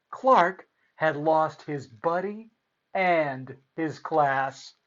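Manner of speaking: plain reading voice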